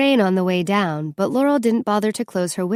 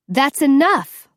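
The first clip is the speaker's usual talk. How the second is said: sharp, higher angry shout; yelling; 6 semitones higher, 4 dB louder, slower than usual. A narrator's reading. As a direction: cut them off with a yell. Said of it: "Laurel yelled".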